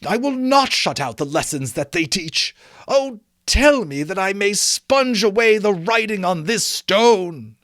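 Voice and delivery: put-on old Scrooge imploring the ghost; Scrooge pleading. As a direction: beg the spirit, voice lifted high. Scrooge voiced in story reading.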